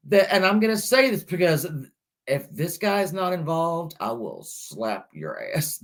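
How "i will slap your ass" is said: low, slow playful menace; mock threat. Host jokingly threatens a suspect to her viewers, low mock menace. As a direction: growl it like a fake tough threat. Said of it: "No, I'm just kidding".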